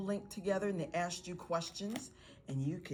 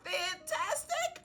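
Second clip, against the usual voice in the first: high, drawn-out parent cheer; excited praise; 14 semitones higher, slower than usual. Acted. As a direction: celebrate the child's answer, pitched way up. Parent cheering the child's answer.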